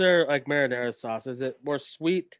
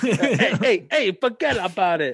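louder, higher hammed-up mobster, half laughing; mock wise guy; 5 semitones higher, 5 dB louder, slower than usual. Mocking the wrestler's un-Chicago accent, hammed-up wise guy voice. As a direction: play a hammy East Coast wise guy. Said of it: "doesn't sound like he's anywhere near Chicago".

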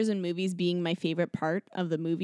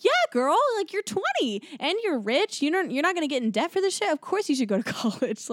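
excited; 9 semitones higher than usual. Pretend. Talking back to the character.

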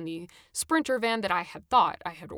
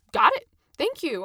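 much louder and higher, bright and chirpy; cheerful thanks; 7 semitones higher, 7 dB louder than usual. A narrator's reading. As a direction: thank her with real cheer. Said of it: "I said cheerfully".

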